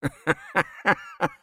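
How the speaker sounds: high, staccato mocking laugh; acted burst of spiteful laughter; vengeful laugh. A narrator's reading. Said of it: "خندهٔ انتقام جویانه ای سر داد".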